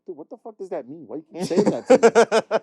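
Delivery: rising into a loud baffled yell; exasperated outburst. Retold or imitated. Retold coworker scene, building exasperated yell. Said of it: "I was like".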